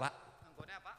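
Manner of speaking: normal talking voice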